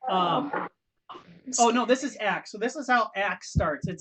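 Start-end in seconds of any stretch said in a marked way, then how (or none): none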